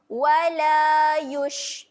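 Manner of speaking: slow, loud "Wala yush" with air on sh; breathy shin. Shown as a demonstration. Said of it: "shin ketika mati sifatnya kena keluar". ("yush" keluarkan angin)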